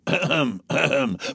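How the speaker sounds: pompous mock throat-clearing. A narrator's reading. Colonel's throat-clearing, acted.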